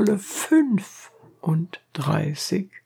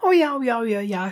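chattering; 8 semitones higher, much faster than usual. A narrator's reading. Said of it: "schnatterten die Abderitinnen".

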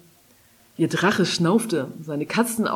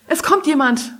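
raised, crisp announcement in a strange voice; cool warning; 8 semitones higher, 4 dB louder than usual. A narrator's reading. Falcon warns Kari inside her head, cool and sudden. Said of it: "kühle Stimme in Karis Kopf".

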